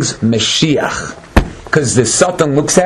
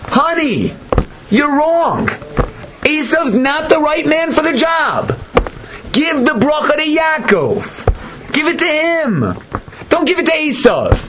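higher, earnest wife's plea; pleading wife pitched up high; a hint of Rivka; 9 semitones higher than usual. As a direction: coax him gently in a raised voice. Rivka voiced urging her husband.

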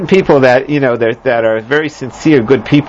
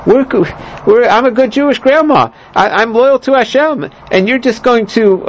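pleading grandma; 7 semitones higher than usual. Retold or imitated. Voicing the wise grandma pleading.

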